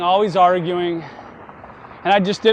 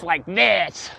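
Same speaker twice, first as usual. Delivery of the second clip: imitating a really raspy voice; raspy neighbour voice. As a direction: put a rasp on it, mimicking her. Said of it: "she had a really raspy voice".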